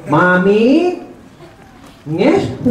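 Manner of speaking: lightly voiced child with a nasal impediment; a hint of a nasal-speech kid. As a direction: mimic a boy who can't say his consonants. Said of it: "Sasabihin ng ngongo".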